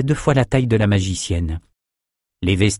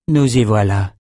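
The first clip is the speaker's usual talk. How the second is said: plain reading voice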